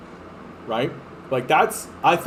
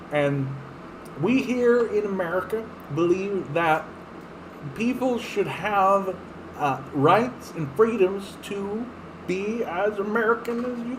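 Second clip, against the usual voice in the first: put-on higher, softer old-man Biden; folksy old Biden speech, a bit higher; Biden impression; 5 semitones higher, 4 dB quieter than usual. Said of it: "that's the best I think I can do with Joe Biden".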